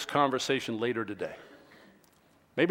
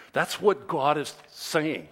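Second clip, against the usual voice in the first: usual speaking voice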